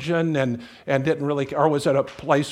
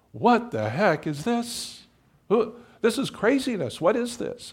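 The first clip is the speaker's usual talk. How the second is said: baffled newcomer; 7 semitones higher than usual. Retold or imitated. Baffled newcomers at dramatic service.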